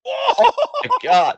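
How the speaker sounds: high, drawn-out cry of astonishment; astonished. Real, not acted. Wrestling watcher reacting to a big move, astonished. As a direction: stretch it out high in real shock.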